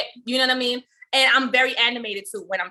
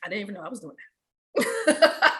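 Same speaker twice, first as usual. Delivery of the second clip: stunned realization; 3 semitones higher, 4 dB quieter than usual. Retold or imitated. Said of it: "had to sit back and go".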